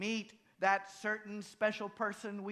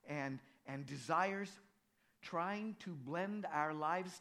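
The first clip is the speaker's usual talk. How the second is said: soft, earnest prayer voice; 3 semitones lower, 3 dB quieter than usual. Acted. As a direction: pray it quietly and sincerely. Leads a prayer.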